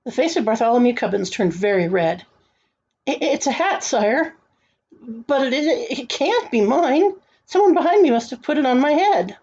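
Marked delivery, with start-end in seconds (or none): 3.1-9.4 high, stammering excuse; flustered. A narrator's reading. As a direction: stumble through a nervous explanation, pitch raised. Flustered boy excuses himself to king.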